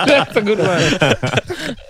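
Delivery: high, slow words through laughter; laughing. Real, not acted. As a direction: laugh hard while praising. Laughing at the joke.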